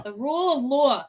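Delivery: lightly imitated New York accent. Co-host echoes the accent she loves, playful New York imitation. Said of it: "I love his accent so much".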